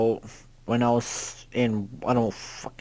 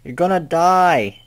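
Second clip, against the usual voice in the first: higher, louder, slow cry; panicked yell; 5 semitones higher, 6 dB louder than usual. Real, not acted. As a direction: yell the warning in alarm. Yelling at the character.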